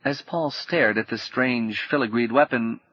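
ordinary delivery, nothing marked

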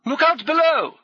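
high, loud call from above; full-voiced hail; shouted warning. A narrator's reading. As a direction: holler it down to people below.

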